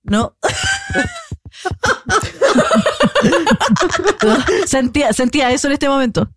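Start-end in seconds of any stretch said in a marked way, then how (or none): 0.4-4.6 long, high-pitched, breathless cackle; high laughing fit. Real, not acted. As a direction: burst into helpless high laughter. Reacción a su propia anécdota. (carcajada aguda y larga)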